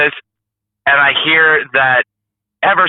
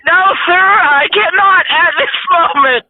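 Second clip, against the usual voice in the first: raised, slower, strained reply; high-pitched voice; slower than usual. Retold or imitated. Trucker stuck on the trash can refusing the officer, high and slow. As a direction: squeak it out politely, slowly. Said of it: "you had the high-pitched voice".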